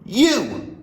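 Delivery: sharp, high, loud bark. Acted. Headmistress singling out a small boy, barked loud. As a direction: stab the word at the boy. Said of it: "she barked".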